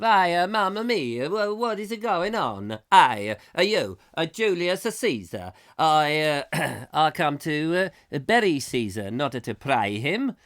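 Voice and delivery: mock Italian Roman orator; Italian accent. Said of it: "I don't know quite what accent that's turned into".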